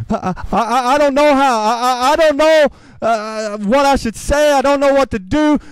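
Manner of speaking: halting starts, then words tumbling out in protest; flustered, stammering prayer. Acted. Preacher acting out someone unready to be God's voice, flustered stammering. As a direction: stammer helplessly on repeated starts. Acts out unsure prayer to God.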